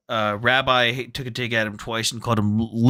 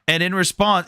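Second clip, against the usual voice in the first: ordinary talk, nothing marked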